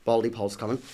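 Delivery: ordinary talk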